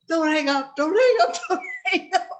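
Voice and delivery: high, loud frantic plea through laughter; yelling, re-enacted. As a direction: yell it desperately while cracking up. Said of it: "and then I'm yelling".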